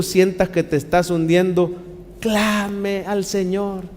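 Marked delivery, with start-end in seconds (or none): 2.2-3.8 soft, slow exhortation. Acted. Exhortación solemne.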